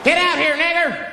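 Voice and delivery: high, loud mock threat; sudden high-pitched acted shout; hostile yell. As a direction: yell it like a mob outside. Hooded men yell at caller.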